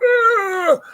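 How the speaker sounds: high startled cry of defeat; shocked squawk. Retold or imitated. Professors proven wrong by the terminal, high shocked yelp. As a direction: squeal like the caught-out know-it-all.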